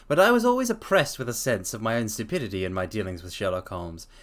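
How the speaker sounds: usual speaking voice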